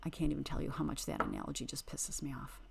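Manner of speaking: fast, whispered rant; fuming whisper. Real, not acted. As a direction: rattle it off in a seething whisper. Fuming about the analogy.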